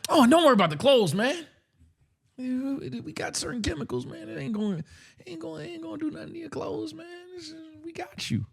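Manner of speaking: high, quick, hushed mock salesman. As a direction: brush it off like a slick seller. Mocks the salesman's reassurance.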